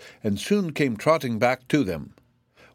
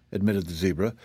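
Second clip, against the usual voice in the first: ordinary delivery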